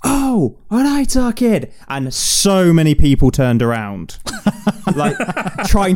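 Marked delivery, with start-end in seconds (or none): 0.0-1.7 high-pitched mock call in Mancunian; imitated shout. Recalling someone shouting in Piccadilly station, imitated shout. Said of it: "someone shouting".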